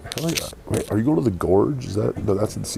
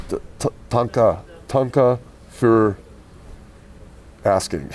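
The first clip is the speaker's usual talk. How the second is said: caveman thanks; 4 semitones higher, 5 dB louder than usual. Pretend. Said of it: "Oh, it's Ugbaga".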